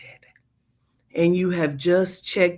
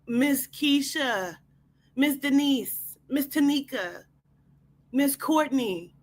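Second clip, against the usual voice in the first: higher, softer attendant voice, name by name; quietly ominous warning to the guest; insistent attendant; 6 semitones higher, 4 dB quieter than usual. Acted. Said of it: "She says it again".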